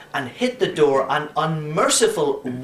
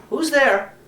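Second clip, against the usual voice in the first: higher, questioning call from the dark box; a startled priest calling out; priest's voice; 10 semitones higher, 3 dB louder than usual. A narrator's reading. Priest calls into the dark box, questioning. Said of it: "a man's voice said".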